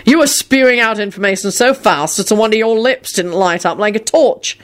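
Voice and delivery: plain reading voice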